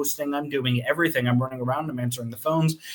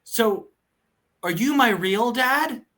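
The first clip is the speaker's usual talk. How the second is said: put-on high, slow innocent child question; a kid asks the owner if he is his real dad; imitating kid; 8 semitones higher, slower than usual. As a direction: ask it wide-eyed like a small child. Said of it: "the kid goes".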